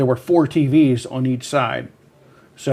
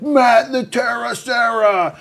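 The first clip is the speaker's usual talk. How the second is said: excited shout; 10 semitones higher, slower than usual. Retold or imitated. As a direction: yell it like a starstruck fan. Fan shouting name.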